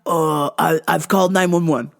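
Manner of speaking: an EMT putting on a firm, official tone; defensive EMT. Retold or imitated. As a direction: hold the mom back with a lightly voiced official tone. EMT answering the angry mom.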